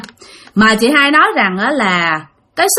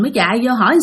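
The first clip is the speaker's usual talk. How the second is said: ordinary delivery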